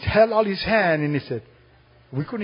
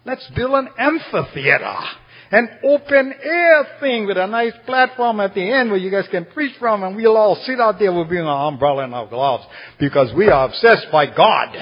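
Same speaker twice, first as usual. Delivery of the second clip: loud, high, fervent proposal; excited retold pitch; 5 semitones higher, 4 dB louder than usual. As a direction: pitch the idea at preaching volume, easing down in pitch. Church members pitch idea, retold.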